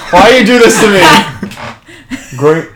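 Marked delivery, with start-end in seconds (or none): 0.1-1.0 laughing. Real, not acted. Teased, laughing protest.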